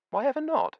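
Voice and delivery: the widow insisting, high and sweet; sweet old landlady. A narrator's reading. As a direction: press him brightly like a sweet old hostess. Missus Beatty presses him.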